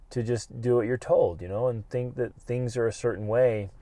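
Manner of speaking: ordinary talk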